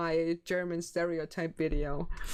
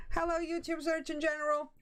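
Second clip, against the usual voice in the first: higher-pitched, rapid read-out; high quick greeting; 8 semitones higher, faster than usual. Real, not acted. Streamer reading out a newcomer's greeting, high and quick. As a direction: rattle it off up high.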